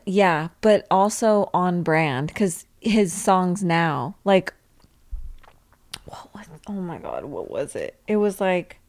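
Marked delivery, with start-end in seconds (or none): 6.1-8.0 soft whisper, dropping lower. Real, not acted. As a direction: whisper while searching memory. Trying to remember name.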